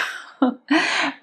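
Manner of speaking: bright burst of real laughter. Real, not acted. Laughing at the deal she set her husband. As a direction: laugh at her own bold terms.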